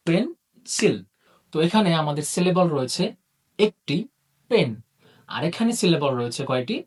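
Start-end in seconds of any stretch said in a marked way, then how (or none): 0.1-1.0 "pencil" in two higher beats, পেন, pause, সিল; syllable by syllable. Shown as a demonstration. Teacher demonstrates the clapping trick, "pencil" broken as পেন... সিল. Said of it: "যদি আমরা হাততালি ট্রিক্সটা কাজে লাগাই".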